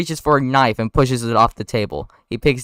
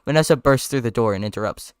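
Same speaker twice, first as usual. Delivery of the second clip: usual speaking voice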